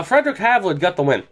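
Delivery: ordinary talk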